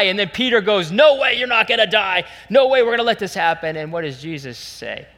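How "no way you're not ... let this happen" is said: high, loud refusal, acted out; vehement protest. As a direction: refuse outright as an alarmed disciple. Peter protesting Jesus' death, acted.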